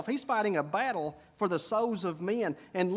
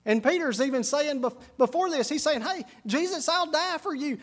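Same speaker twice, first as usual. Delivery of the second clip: higher, louder storytelling preach; preaching at full force; 6 semitones higher, 4 dB louder than usual. Acted. Preacher telling of Peter's boast, raised and louder. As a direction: build the scene with rising force.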